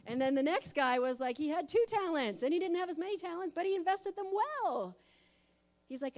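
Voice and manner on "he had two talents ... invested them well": louder, higher and fast, building energy; excited. Real, not acted. Preacher retelling the parable of talents, excited and fast. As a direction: rush through it with enthusiasm.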